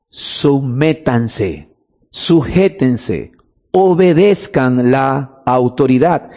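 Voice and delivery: very slow and low, word by word; solemn emphatic command. Real, not acted. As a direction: spell out each command with deliberate weight.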